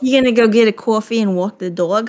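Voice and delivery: put-on New York accent, broad vowels. Teasing a friend about coffee and dog, mock New York accent. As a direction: ham up a New Yorker's accent. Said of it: "New York accent".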